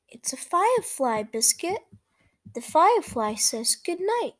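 lively read-aloud for small listeners; reading to children. A narrator's reading. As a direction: read it brightly to little kids. Reads picture book to children.

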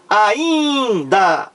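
"Ainda" drawn out with a long, strong "in"; stress on IN. Shown as a demonstration. Teacher pointing out the tonic syllable, "Ainda" stretched on "in". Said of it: "É a sílaba tônica".

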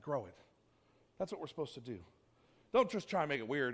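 ordinary talk, nothing marked

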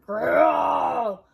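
loud, drawn-out growling roar; bear roar. A narrator's reading.

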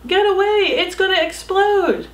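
high, loud panicked shout; shouted warning. Acted. Pilots shouting at the villagers to get away, loud and high. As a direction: yell them back from the burning helicopter. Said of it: "the two military pilots shouted".

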